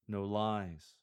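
ordinary delivery

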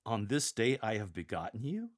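rising, doubting question; incredulous questioning. Shown as a demonstration. Lector putting the angels on trial, incredulous. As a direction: turn each statement into a disbelieving question. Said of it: "Act like the angels are on trial".

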